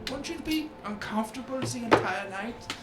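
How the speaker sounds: high, arch fae lord teasing; Oberon, needling. Acted. Oberon mocking Blythe's armor, high and arch. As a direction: look down your nose at her armor.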